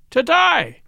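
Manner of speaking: high-pitched exultant cry, not very loud; shriek. A narrator's reading. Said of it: "he shrieked".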